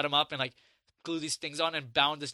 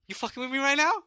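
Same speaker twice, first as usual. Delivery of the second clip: high-pitched, confrontational challenge; acted-out indignant question; incredulous outrage; 10 semitones higher, 4 dB louder than usual. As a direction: replay being offended, pitch jumping up. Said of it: "that's my reaction".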